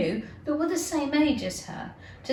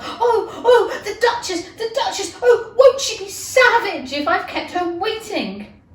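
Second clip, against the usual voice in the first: much higher, louder, panicky rabbit voice with a laugh; 8 semitones higher, 8 dB louder than usual. Acted. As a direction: squeak it out in a frantic hurry. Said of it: "muttering to himself".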